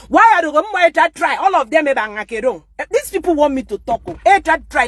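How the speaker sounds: loud, high, fired-up rant; heated shout. Real, not acted. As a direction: shout it at them, fed up.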